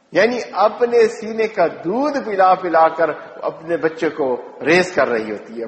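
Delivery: ordinary talk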